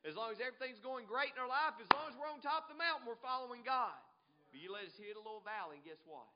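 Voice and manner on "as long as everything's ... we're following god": high, loud, mounting list; rousing preaching. Real, not acted. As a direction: pile it up like a fired-up preacher.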